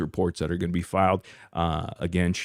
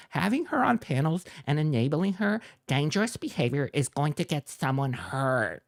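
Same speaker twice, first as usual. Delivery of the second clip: higher, whiny mocking voice; mock reading; 7 semitones higher than usual. Reading out the rival's post to viewers in a higher mocking voice. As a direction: sneer his words back in a higher voice. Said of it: "reading the rival's post".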